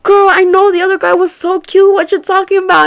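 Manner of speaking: put-on high, loud girlfriend teasing; high loud sassy friend; friend's voice. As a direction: tease her like it's obvious. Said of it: "she's like".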